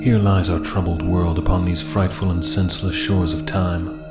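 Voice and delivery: ordinary delivery, nothing marked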